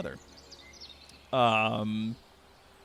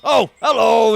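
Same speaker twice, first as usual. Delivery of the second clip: loud, high-pitched hail; orc's greeting back; 8 semitones higher, 10 dB louder than usual. Acted. Orc answering a stranger's wave, calling out. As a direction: call back a surprised hello. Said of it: "the orc just goes".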